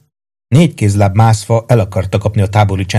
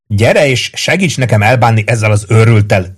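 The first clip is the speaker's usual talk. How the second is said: plain reading voice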